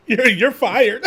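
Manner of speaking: slow, high, laughing through it; laughing mock firing. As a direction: fire them jokingly, cracking up. Joking sacking, breaks into laughter.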